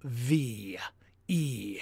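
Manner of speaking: slow, sinking spell-out of the word; drawn-out sounding-out. Shown as a demonstration. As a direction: let the word fall apart slowly, trailing down.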